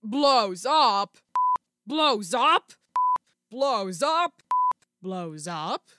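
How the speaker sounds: put-on brawny clone soldier voice; Wrecker. Podcaster testing a clone's explosive line, put-on soldier voice. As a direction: try out a big demolition man's catchphrase, varying each take. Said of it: "no, not Wrecker now".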